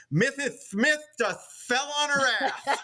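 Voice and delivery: put-on little boy blurting it loudly to the crowd; boy's punchline. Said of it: "say something in a loud and clear voice".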